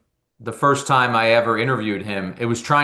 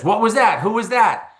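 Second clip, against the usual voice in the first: high, sharp startled questions; startled George; 10 semitones higher than usual. Retold or imitated. Boss startled by a voice on the extension, high and sharp. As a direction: caught off guard by a voice on the line.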